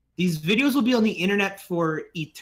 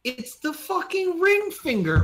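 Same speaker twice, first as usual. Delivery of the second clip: very high-pitched incredulous rant; worked-up, squeaky exasperation; exasperated disbelief; 10 semitones higher than usual. Real, not acted. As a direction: scold a clueless friend in high-voiced outrage. Exasperated at friend's ignorance of ring finger.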